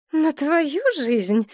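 high, slow puzzled question; bewildered. A narrator's reading. Mother hearing her daughter's claim, slow bewildered question. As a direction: stretch it out, not believing. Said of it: "в недоумении проговорила мать".

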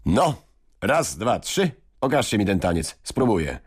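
a mild, high order; half-playful royal command. A narrator's reading. King ordering the boys to show the dance, mild command. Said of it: "zaordynował".

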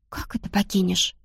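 surprised, breathless whisper; startled whisper. Acted. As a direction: whisper it in shock. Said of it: "от неожиданности чуть не подавилась". (испуганный шёпот)

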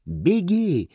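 sharp high warning shout. A narrator's reading. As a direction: cry out for him to run. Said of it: "крикнула Мэй".

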